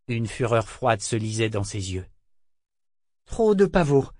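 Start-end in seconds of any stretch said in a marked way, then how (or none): 3.2-4.1 high, louder short answer; terse explanation. A narrator's reading. Boy explaining Althea's state to Jek, high and terse. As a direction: explain it bluntly.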